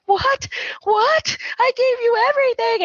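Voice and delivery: very high, distraught squeal; hammed-up wounded wife; mock wailing. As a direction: wail it in a put-on high voice. Retold tearful wife pleading.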